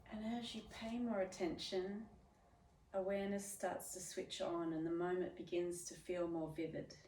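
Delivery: soft, unhurried meditation voice with long pauses; guided meditation. Real, not acted. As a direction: guide them gently inward, letting each phrase settle. Leads a meditative check-in.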